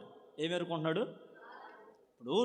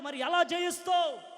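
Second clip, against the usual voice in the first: mocking shout; 9 semitones higher than usual. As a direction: hurl the question back at him, drawn out. Onlookers repeat the challenge.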